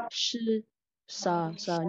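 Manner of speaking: "sư" and "sờ" with curled-tongue sh; heavy retroflex sh. Shown as a demonstration. Teacher models pinyin sh, heavy retroflex "sư". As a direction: curl the tongue up for "sư", "sờ". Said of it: "S H thì giống là chữ sờ nặng".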